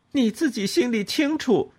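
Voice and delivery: 高声尖锐地抢白; 话里带刺地逼她; 愤怒顶撞. A narrator's reading. 和婆婆争吵. (高声愤怒地抢白)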